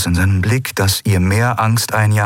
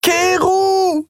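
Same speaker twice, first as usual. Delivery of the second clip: very high, drawn-out scream of a name; woman screaming after a leaving ship. A narrator's reading. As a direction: scream after him as he vanishes. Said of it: "schrie sie".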